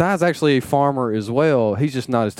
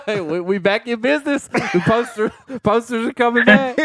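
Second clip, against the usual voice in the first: laughing excitedly; 9 semitones higher than usual. Real, not acted.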